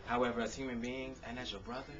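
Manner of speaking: rhythmic performed poetry with punchy builds; spoken word poem. Acted. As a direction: perform it like a slam poem on an open mic stage.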